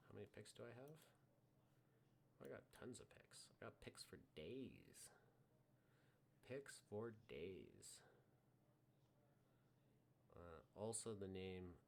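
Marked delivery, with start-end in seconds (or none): 3.6-5.2 a slow hushed whisper. Pretend. To the viewers, savouring his many picks, whispered slowly. Repeats phrase in two ways. 6.5-8.0 very slow, each word stretched; drawn out. Pretend. Echoing his own boast, drawn out very slowly. Repeats phrase in two ways.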